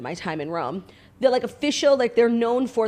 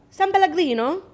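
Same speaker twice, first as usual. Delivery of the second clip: San Pellegrino said higher with a showy Italian accent; 9 semitones higher, 3 dB louder than usual. Shown as a demonstration. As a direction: show off an Italian accent on the brand name. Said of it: "I got really good at the accent".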